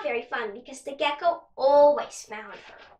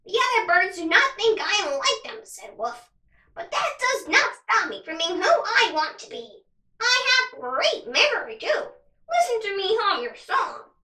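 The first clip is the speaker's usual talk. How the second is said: raspy crow voice; 6 semitones higher, 4 dB louder than usual. Acted. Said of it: "In a raspy voice".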